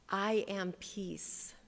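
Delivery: very slow, each word weighted; slow spoken mantra; slow affirmation. Shown as a demonstration. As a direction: lay down each word slowly. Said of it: "Say it with me".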